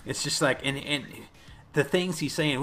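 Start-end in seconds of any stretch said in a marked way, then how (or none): none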